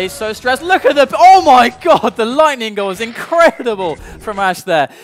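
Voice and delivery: very loud, high, hyped call; esports caster. Acted. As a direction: lose it over the perfect play. Excited live play-by-play.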